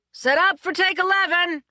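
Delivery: high, loud call to the crew; an assistant director yelling to the crew; yelled. A narrator's reading. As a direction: shout the setup order. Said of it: "yelled the assistant director".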